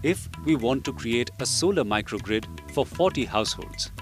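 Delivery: normal talking voice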